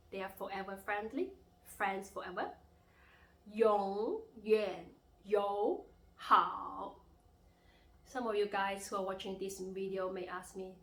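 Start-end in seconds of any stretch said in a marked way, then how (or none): 3.5-6.9 永远友好 very slow and loud, swooping tones; teacher's exaggerated tone drill on 永远友好; third-tone demo. Shown as a demonstration. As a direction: stretch each syllable of 永远友好 through its dip and rise. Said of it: "symbol of the third tone".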